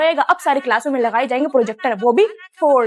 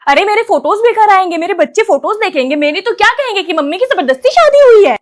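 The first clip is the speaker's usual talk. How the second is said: loud, higher, worked-up protest; excited protest; 4 semitones higher, 7 dB louder than usual. Acted. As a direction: argue loudly about the wedding photos. Bride argues with mother. (जोश में ज़ोर से बहस)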